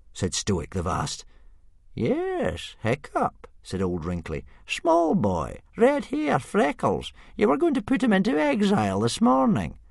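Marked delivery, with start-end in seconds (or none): 1.9-9.8 put-on high-pitched old man's voice. Old Wrinkly reminds Stoic about his exiled son, high old voice. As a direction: jog the chief's memory pointedly.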